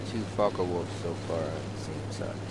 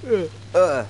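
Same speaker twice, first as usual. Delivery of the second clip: loud, high yawn sound; a big involuntary yawn; yawning mid-commentary; real yawn; 9 semitones higher, 9 dB louder than usual. Real, not acted. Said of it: "apologize for the yawning".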